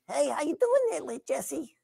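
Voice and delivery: very youthful, high puppet voice; high-voiced, innocent young puppet character; Wimbley. Shown as a demonstration. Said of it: "that was a very youthful voice that I did for Wimbley".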